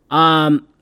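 usual speaking voice

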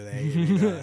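airy valley girl, a hint of it. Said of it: "That's [name]'s Valley Girl".

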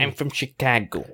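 attempted Chicago accent; put-on Chicago voice; Midwest accent. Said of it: "do a Midwest accent".

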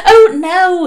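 high, loud, flustered nun; hurried denial. A narrator's reading. Nun correcting the startled father, hurried denial. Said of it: "said Sister Mary hurriedly".

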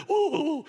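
mock sob. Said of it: "I'm just bellowing".

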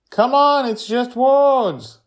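high, loud, drawn-out coaxing; urging young Joy. A narrator's reading. Girl urges Charlie to say the word, high and insistent. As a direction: push him in a loud kid voice. Child urges her friend on.

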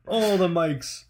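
laughing through the words. Real, not acted. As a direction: chuckle the joke out. Laughing joke.

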